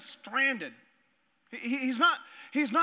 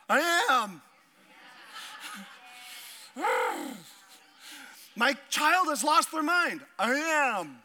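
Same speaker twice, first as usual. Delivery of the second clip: mock stalling kid; 5 semitones higher, slower than usual.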